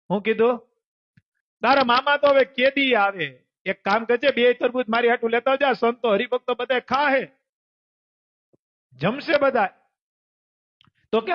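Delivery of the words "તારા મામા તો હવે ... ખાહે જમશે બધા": calling out loud. Acted. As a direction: shout after the boy, higher and louder. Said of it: "રાડ પાડી". (મોટેથી રાડ પાડીને બોલાવવું)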